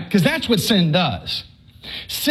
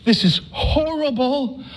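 slow, higher, mock horror; first confession, a slow higher groan of dismay; higher, slower dismay; 6 semitones higher, slower than usual. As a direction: recoil at the confession. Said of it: "I was like".

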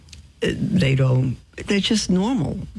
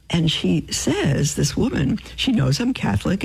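normal talking voice

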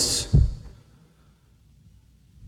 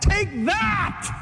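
triumphant shout; 11 semitones higher than usual. Pretend. Preacher imagining wiping everything out.

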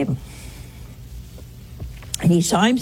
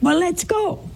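high-pitched, eager imitation; retold excitement; 10 semitones higher than usual. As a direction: pitch leaps up, eager to set off. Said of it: "he was so excited".

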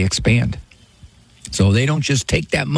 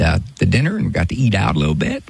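ordinary talk

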